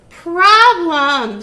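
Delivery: loud high witch screech. Acted. Witch screeching at the missing piggies. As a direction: shriek it out in fury. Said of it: "screeched Gritch".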